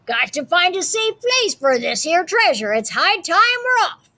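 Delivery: high, hearty pirate call; pirate announcement. Acted. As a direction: announce it like a captain. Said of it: "he announced".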